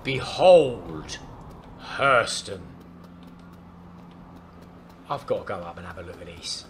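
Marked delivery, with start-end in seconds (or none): 0.0-2.6 grand announcement. Acted. Unveils the city grandly.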